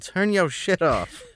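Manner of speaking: high, slow drawn-out complaint; mock annoyed yell. Taco Bell worker to noisy driver, mock annoyed yell. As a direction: imitate fed-up staff calling out over a noise. Drive-thru staff complaining about car noise.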